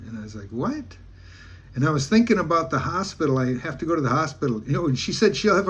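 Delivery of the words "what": high, softer, rising "what?"; surprised question. Retold or imitated. Teller reacting to her offer of a girlfriend, surprised question. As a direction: act out being taken aback, pitch shooting up.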